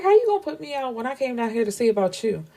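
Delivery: high, fast retold objection; indignant protest. Woman protesting being sent away after her visit, indignant and fast. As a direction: object in a quick, high rush.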